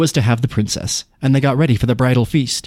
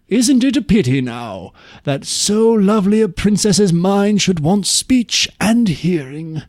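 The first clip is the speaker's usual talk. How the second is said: lightly higher, slower pitying voice; a hint of a sad king; downcast king; 7 semitones higher than usual. A narrator's reading. King to True as the princess passes, mildly downcast. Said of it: "he said to True".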